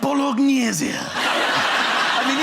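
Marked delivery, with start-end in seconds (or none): 0.0-0.9 Bolognese pronounced pol-og-NEH-zee, louder and higher; posh Prince Charles. Retold or imitated. Mocking Prince Charles ordering pasta, posh Italianate Bolognese. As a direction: roll out Bolognese in plummy Italian style. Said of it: "in a way that was so posh".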